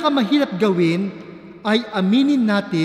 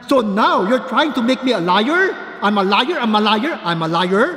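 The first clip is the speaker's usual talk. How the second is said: angry shouting; 4 dB louder than usual. Retold or imitated. Student yelling at the MRT security guard, furious. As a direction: rant at the guard, shouting her down. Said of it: "na pasigaw na bumubulyaw".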